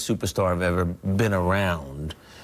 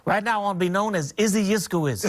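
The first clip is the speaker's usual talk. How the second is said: higher boastful champ voice, lightly done; light Ali impression, higher and cocky; Muhammad Ali; 10 semitones higher than usual. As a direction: brag to the room like the young champ. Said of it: "really good Ali imitation".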